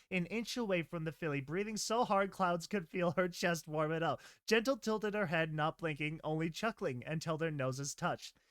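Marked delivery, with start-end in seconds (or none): none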